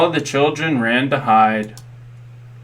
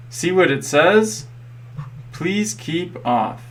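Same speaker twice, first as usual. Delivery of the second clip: slow, drawn-out reading of the sign; 5 semitones higher, slower than usual. A narrator's reading. Danny reading the park sign to the dinosaur, slow and deliberate. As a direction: spell out the sign slowly for a friend. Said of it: "said Danny".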